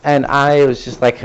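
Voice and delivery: normal talking voice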